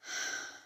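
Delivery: audible wordless sigh. Real, not acted. Pausing in a psalm on loneliness, a long sigh. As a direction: let out a sigh between verses. Sighs midway through the psalm.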